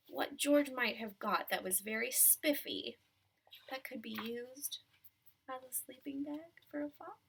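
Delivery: ordinary delivery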